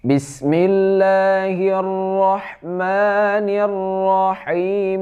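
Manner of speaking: high, melodic chanted tilawah; connected recitation of the opening verses; Quran recitation. Shown as a demonstration. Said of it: "begitu cara bacanya kalau kita sambung".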